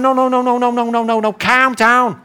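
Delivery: high rapid-fire no's into Scouse "calm down"; insistent mock Scouse soothing; Liverpudlian accent. Peter to his drowning crew, insistent in Scouse accent. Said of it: "he should have been saying to the guys".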